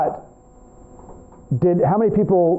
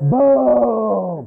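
drawn-out, higher "boom"; mock explosion boom, pitched up; imitated explosion; 4 semitones higher than usual. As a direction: mimic a blast with one long boom. Acts out televisions exploding.

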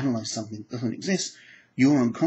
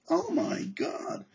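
mock dismay; 4 dB quieter, much slower than usual. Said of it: "mock dismay".

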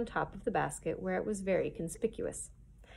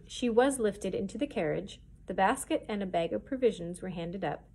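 usual speaking voice

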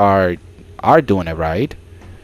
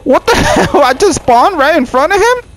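much higher, laughing in disbelief; shocked disbelief; 4 dB louder than usual. Real, not acted. Player spawning before the monster, high shocked squeal. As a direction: squeal it out, caught off guard.

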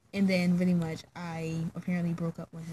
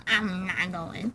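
retold laughing refusal; much slower than usual. Laughs while retelling her refusal.

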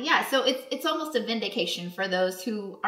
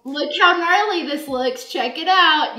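loud, showing off a gnarly scar; bro voice; 5 semitones higher, 7 dB louder than usual. Acted. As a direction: hype it up like a dude-bro. Joining the bro voice bit.

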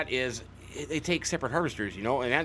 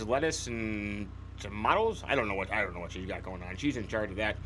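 mumbled aside; 7 semitones lower, 5 dB quieter than usual. Acted. Unsure what Sophie is growing.

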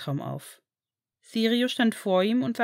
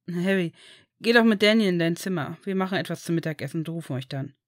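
plain reading voice

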